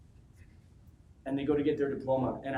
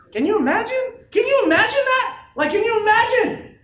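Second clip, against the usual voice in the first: booming, much higher shouts that keep building; shouted; 11 dB louder than usual. Real, not acted. Speaker to his team, roaring about the guest speaker. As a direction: roar it, then roar it again harder. Hyping crowd about helicopter.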